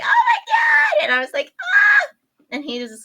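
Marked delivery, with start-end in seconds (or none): 0.0-1.0 very high, loud, drawn-out shriek; full-voiced startled shriek; re-enacted scream. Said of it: "I screamed so hard". 1.6-2.1 high, loud startled shriek; short full-voiced scream of fright; re-enacted scream. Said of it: "I screamed so hard".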